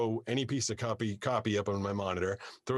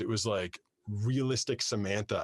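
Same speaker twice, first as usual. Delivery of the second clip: usual speaking voice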